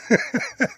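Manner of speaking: short burst of real laughter. Real, not acted. Amused by the Simpsons joke he retold, real laugh. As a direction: laugh at the punchline. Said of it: "That makes me laugh so hard".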